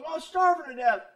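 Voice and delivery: high, loud whiny excuse; hungry thief whining his excuse; pleading thief. Retold or imitated. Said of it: "And he goes".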